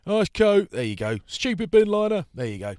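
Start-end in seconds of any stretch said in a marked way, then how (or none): 0.0-0.7 high, loud sarcastic jeer; mocking heckler. Fan mocking the bin liner coat, high loud jeer. 1.2-2.3 high, loud taunting fan voice; jeering heckler. Retold or imitated. Heckler in the street taunting the coat, high jeer.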